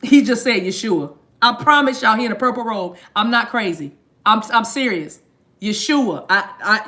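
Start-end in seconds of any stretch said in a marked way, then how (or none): none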